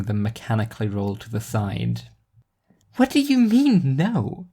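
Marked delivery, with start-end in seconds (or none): 3.0-4.5 put-on a teenage girl pushing back, pitched way up; a bratty teen demanding an answer; high teen girl voice. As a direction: voice Nadine high and indignant. Teen girl challenges strange child.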